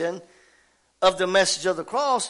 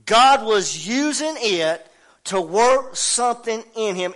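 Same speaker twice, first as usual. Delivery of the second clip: ordinary delivery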